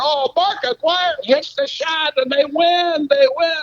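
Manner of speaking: high, rising call of the winning shot; building excitement. Retold or imitated. Anchor calling the winning shot, hammed-up excitement. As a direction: build it up to the buzzer-beater. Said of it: "then I build back up".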